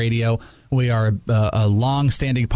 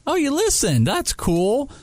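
high, pleased surprise; light flattered surprise; he realizes the producer actually listened, delighted; pleasantly surprised; slower than usual. Retold or imitated.

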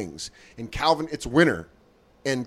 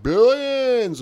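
mock drunk heckler; 9 semitones higher than usual. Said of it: "he's like".

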